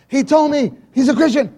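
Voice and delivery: high, loud imitated joy; imitating mother, high and loud; excited mother. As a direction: announce it thrilled.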